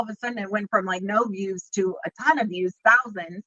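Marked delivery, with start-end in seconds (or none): none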